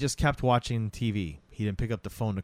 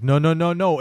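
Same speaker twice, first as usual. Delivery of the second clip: higher, louder frantic no no no; panicked protest; 6 semitones higher, 7 dB louder than usual. Retold or imitated. Man begging the hypnotist not to, higher panicked protest. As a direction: plead against sinking, rising panic.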